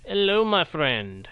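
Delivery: ordinary talk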